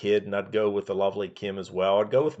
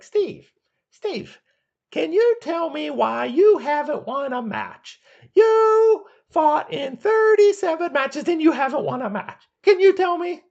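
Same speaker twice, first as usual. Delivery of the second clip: much higher, slow, taunting interviewer voice; Roddy Piper impression; 6 dB louder than usual. Piper mocking a losing wrestler on his talk show, high taunting voice. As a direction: needle him like a cocky heel host. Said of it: "and he's like".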